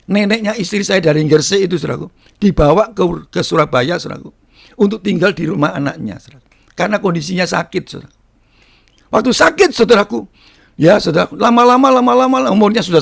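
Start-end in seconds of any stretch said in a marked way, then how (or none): none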